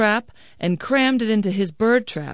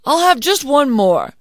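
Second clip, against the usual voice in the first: higher, louder character voice; eager hungry man; 7 semitones higher, 9 dB louder than usual. Acted. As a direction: give in to hunger, pitched up and loud. Hungry man gives in once more.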